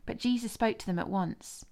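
ordinary delivery, nothing marked

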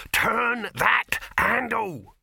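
loud, high, drawn-out scream; screamed slow command. A narrator's reading. Dibbler screaming at the handleman, every word stretched. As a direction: scream each word separately, very slowly. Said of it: "screamed Dibbler".

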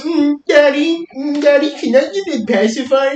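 put-on very high, slow, whiny little-girl voice; baby girl voice. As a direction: coo it like a babyish little girl. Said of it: "she goes".

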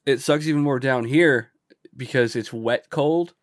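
ordinary talk, nothing marked

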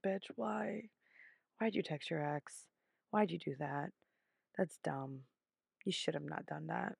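lightly voiced judging viewer; imagined viewer. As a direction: play the audience scolding her. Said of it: "I know what you're thinking".

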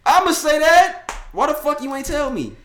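high, very loud indignant yell; mock outrage. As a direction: blow up in comic indignation. Acts out outrage at girlfriend.

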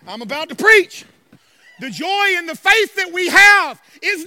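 loud, emphatic church preaching; full-force preaching. Real, not acted. Pastor rousing his congregation, full-force preaching. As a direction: preach it hard, punching the key words.

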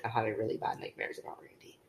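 fast frightened whisper; scared whisper. Retold or imitated. Girl woken by nightmare whispering to her aunt, scared. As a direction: hush it out, shaken from a nightmare.

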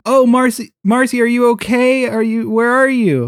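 calling into phone. Acted. Shouting into the phone.

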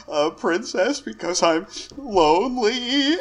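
high-pitched, halting sad sack; lonely, timid Tom. Acted. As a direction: confess pitifully in a high voice, climbing toward tears.

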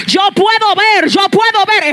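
loud, high preaching cry; shouted declaration. Real, not acted. Preacher shouting to the congregation, loud and high. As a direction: proclaim it at full force.

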